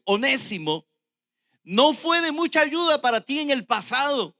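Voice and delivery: loud proclamation easing at the close; preached scripture reading. Real, not acted. Preacher reading the letter about Onésimo, proclaimed. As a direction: declaim it forcefully, then settle on the last phrase. Reads the verse at full force.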